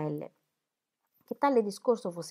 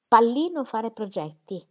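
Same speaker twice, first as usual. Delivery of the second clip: louder, slower, pallino stressed in disbelief; indignant echo; 4 semitones higher, 6 dB louder, slower than usual. A narrator's reading. Dorothea repeating Celia's word pallino, louder and offended. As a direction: throw the word back, offended.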